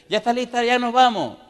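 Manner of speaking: llamando fuerte y agudo desde la puerta; llamada alegre. Retold or imitated. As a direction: grita cantarina, como cada día. Vecina llama como siempre. (llamando a la vecina en voz alta)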